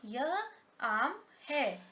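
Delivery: यह, आम, है spaced out slowly; example read word by word. Shown as a demonstration. Teacher reading out a second example, slowly, word by word. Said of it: "दूसरा example देखते हैं".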